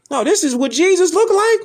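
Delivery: high, scoffing mock question; mock disbelief. To viewers, ridiculing a pale Jesus picture, high mock disbelief. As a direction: act shocked at the picture, then scoff. Mocking the European depiction.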